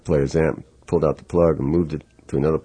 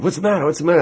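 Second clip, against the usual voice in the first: alarmed asking; 12 semitones higher, much faster than usual. Retold or imitated. Bill alarmed at teller.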